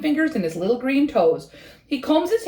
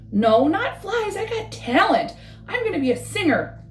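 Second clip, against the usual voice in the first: proud little frog; 7 semitones higher than usual. Acted. Frog tells his pond friends he will sing, high and proud. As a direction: puff up like a frog with big dreams.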